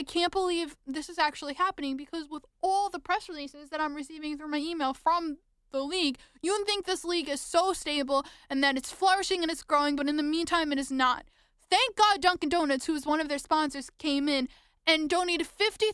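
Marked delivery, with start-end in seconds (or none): none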